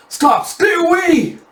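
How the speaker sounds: high, loud scream of terror; acted terrified yell, pitch soaring; panicked shout. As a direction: shriek to keep the monster away. Terrified bandit wards off monster.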